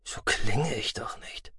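soft, near-whispered protest; quiet, half-whispered inner thought; hushed reply. A narrator's reading. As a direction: answer under the breath. Quiet bewildered reply to the screech.

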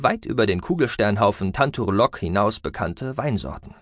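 ordinary delivery

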